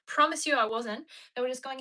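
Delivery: ordinary delivery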